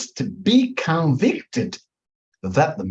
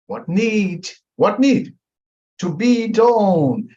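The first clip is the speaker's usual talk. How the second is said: higher, louder "What need to be done", slowing on "to be done"; right tone; 7 semitones higher, 3 dB louder, slower than usual. Shown as a demonstration. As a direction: ask "What need to be done" firmly, pitched up. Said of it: "right tone".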